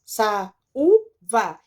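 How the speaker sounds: SAÚVA split into sa, u, va with pauses; syllable by syllable. Shown as a demonstration. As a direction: break "Saúva" into three separate syllables. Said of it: "O a e o u ficaram separadas".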